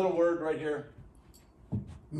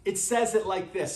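normal talking voice

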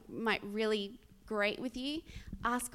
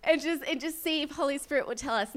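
loud, high, quick call to everyone; excited rallying call; 6 semitones higher, 9 dB louder, faster than usual. Retold or imitated. Telling everybody to lay hands on the car, excited rallying call. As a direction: shout it eagerly, half laughing.

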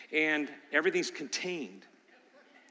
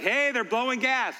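higher, surprised call about the smell; mock alarm; 10 semitones higher, 7 dB louder than usual. Grandpa telling the congregation about the baby, mock alarmed. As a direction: sound the alarm as if shocked. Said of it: "and like".

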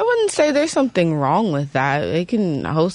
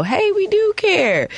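high, drawn-out fake concern; hammed-up pretend caring; mock propaganda; 13 semitones higher, slower than usual. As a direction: put on a slow, high show of caring. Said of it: "propaganda or something to act like".

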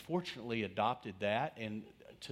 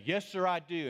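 firm, higher and louder declaration; bold answer; 8 semitones higher, 5 dB louder than usual. Retold or imitated. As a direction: stand tall and say it with a chest. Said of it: "Or I could say".

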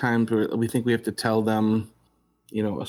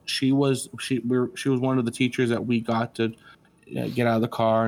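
ordinary talk

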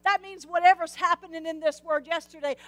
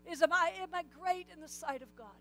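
usual speaking voice

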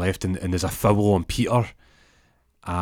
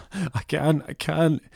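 scared refusal; 8 semitones higher, slower than usual. Retold or imitated. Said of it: "And Peter's like".

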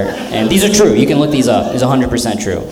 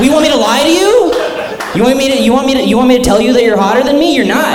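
much higher, giggling teasing; laughing taunt; 12 semitones higher than usual. Real, not acted. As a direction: tease the crowd through laughter. Teasing the audience about looks.

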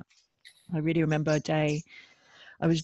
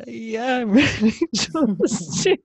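higher, louder, slow with a teary laugh; tearful release; 6 semitones higher, 6 dB louder, much slower than usual. Retold or imitated. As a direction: let it break out with tears. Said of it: "And I".

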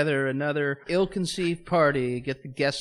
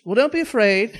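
loud, high hammed-up psychic; mock reassurance; 10 semitones higher, 11 dB louder than usual. Psychic to doomed party guest, loud mock reassurance.

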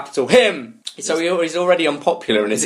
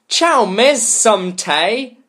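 drawn-out Italian greeting; 6 semitones higher, much slower than usual. Acted. Reading the boy's greeting.

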